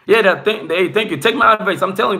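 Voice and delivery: ordinary talk, nothing marked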